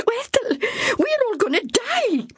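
very high, despairing wail; terrified. Acted. Myra to Ethel in the dark cabin, terrified despair. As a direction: she truly believes they're doomed. Myra's fear peaks.

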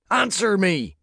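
high, loud furious snap; spat shout. Acted. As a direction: spit the words at her. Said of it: "he spat".

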